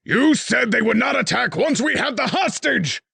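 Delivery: high, loud furious yell; a king roaring at a failed servant. A narrator's reading. King yells at his spy master, furious high shout. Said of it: "the king yelled".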